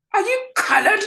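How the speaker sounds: high, hammed-up doctor's question; mock posh doctor. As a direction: sing it out like the startled posh doctor. Doctor's shocking question retold.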